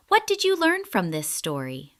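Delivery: usual speaking voice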